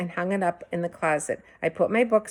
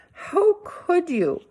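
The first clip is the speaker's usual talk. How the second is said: very slow, much higher wail; accusing wail; 12 semitones higher, 3 dB louder, much slower than usual. A narrator's reading. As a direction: drag it out in disbelief. Boy accuses mother.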